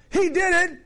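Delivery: put-on high squeaky child's shout of blame; squabbling kid. Preacher mimics a child blaming a sibling. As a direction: whine like a little kid pointing the finger. Kids blaming each other in a fight.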